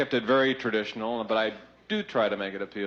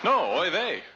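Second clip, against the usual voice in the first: high exclaimed oy vey; Yiddish accent; 8 semitones higher, slower than usual. Acted. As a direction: throw up your hands, oy vey.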